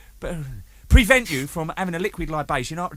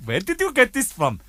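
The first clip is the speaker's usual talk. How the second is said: put-on high Indian-accented questioning; 8 semitones higher than usual. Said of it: "So the guy said".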